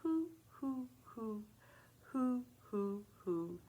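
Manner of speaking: owl hoots. Retold or imitated. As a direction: hoot gently like an owl at night. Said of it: "the owls in the forest say".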